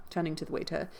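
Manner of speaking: usual speaking voice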